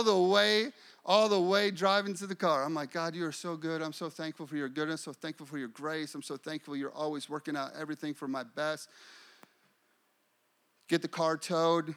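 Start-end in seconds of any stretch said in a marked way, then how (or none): 2.9-8.9 soft, quick retold prayer; murmured praise. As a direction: thank God under your breath, running phrases together. Retells praising God while driving.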